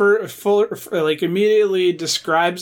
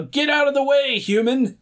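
mock yell; 7 semitones higher than usual. Podcast guest quoting Gambit at humans, mock yell. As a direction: pretend to yell it, pitched up. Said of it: "who will yell".

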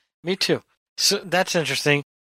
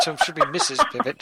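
laughing; 9 semitones higher than usual. Real, not acted. Host jokes about co-host's nickname.

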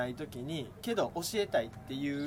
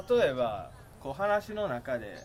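ordinary talk, nothing marked

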